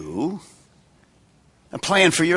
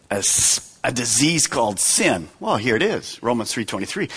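ordinary talk

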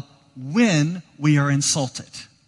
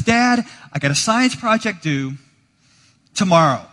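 put-on high, loud eager kid voice; teen son blurting; 4 semitones higher, 6 dB louder than usual. Son telling his dad about tomorrow's project, blurted loud and high. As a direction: blurt it out like a teenager.

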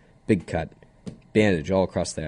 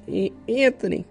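high sing-song name; 13 semitones higher, slower than usual. Retold or imitated. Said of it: "stammering name, sung high".

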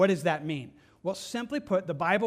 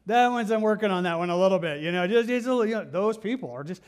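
laughing, louder, a bit embarrassed; sheepish laugh; 2 semitones higher, 5 dB louder, faster than usual. Retold or imitated. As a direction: laugh it off like you've been caught. Sheepish admission about loving people.

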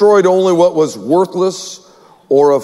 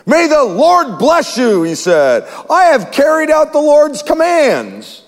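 bright, higher, eager hello; cheerful greeting; 8 semitones higher than usual. A narrator's reading. Saul greeting Samuel as if all is well, cheerful and higher. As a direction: greet him like nothing is wrong. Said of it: "Saul greeted him cheerfully".

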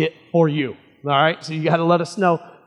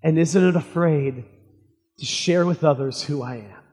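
prayer. Acted. Pastor leads opening prayer.

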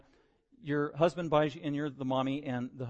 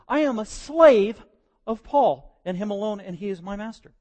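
devoted follower; 7 semitones higher, 12 dB louder than usual. Retold or imitated. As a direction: swear yourself a slave, hammed-up. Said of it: "In other words".

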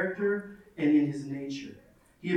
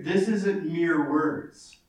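ordinary talk, nothing marked

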